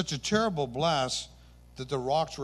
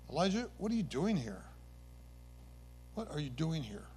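softer, fading call to Elijah; gentle voice of God; 7 dB quieter than usual. Acted. God asking Elijah in the cave, gently and softly. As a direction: ask it quietly, letting the repeat die away. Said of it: "a sound of a gentle whisper".

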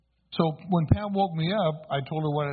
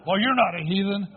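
mildly indignant mother, higher and louder; retold scolding, pitched up with some force; angry mother; 4 semitones higher, 5 dB louder than usual. Angry mother objecting to her son's answer on a school form, mock-indignant. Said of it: "so angry with me".

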